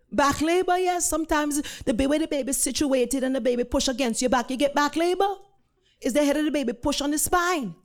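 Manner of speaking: high, quick Jamaican explanation; thick Jamaican accent. Retold or imitated. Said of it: "in a thick Jamaican accent".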